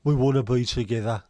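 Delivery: loud mock Brummie advert line; imitating a Brummie from an old advert; Brummie accent. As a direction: belt out the slogan in broad Brummie. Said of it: "in a Brummie accent".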